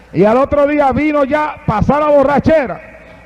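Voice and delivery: ordinary delivery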